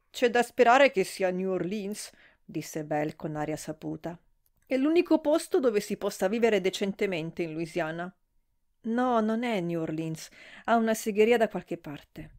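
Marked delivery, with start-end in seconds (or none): none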